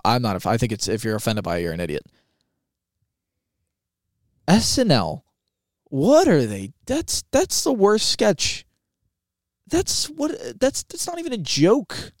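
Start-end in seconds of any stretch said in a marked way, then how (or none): 4.5-12.1 incredulous, high-pitched complaint; exasperated rant. Real, not acted. Host baffled that the sketch got written, exasperated. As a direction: voice pitched way up in disbelief.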